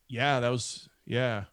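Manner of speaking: hesitant, trailing-off brush-off; halting, noncommittal retold reply; reluctant to talk. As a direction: imitate someone dodging the subject. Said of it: "it sounds like he doesn't wanna talk about it".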